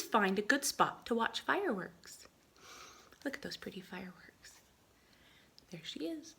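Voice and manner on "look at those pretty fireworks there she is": soft hushed whisper; whispered pointing at the picture; whispered aside. Real, not acted.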